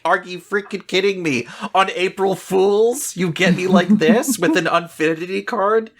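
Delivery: high, incredulous outburst at an April Fool's card; exasperated disbelief. Retold or imitated. Fan who just got tricked by a joke card, disbelieving. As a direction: pitch it way up in mock outrage. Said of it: "and you're like".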